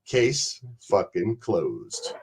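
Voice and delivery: low, very slow, quiet verdict; Jimmy the Pineapple. Retold or imitated. Quoting a friend's catchphrase to end the argument, final. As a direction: drop deep and soft, spacing out each word. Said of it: "my friend Jimmy the Pineapple used to always say".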